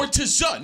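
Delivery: "sportiza" pitched high, falling on the final "za"; mock-Irish pronunciation. Host corrects himself for the Irish, "sportiza" high and drawn into three syllables. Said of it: "is how they say it in Ireland".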